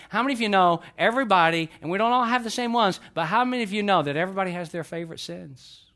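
usual speaking voice

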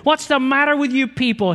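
high, loud yell for the whole cabin to hear; an angry passenger shouting; loud angry outburst. Retold or imitated. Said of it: "he said loudly enough for the whole cabin to hear".

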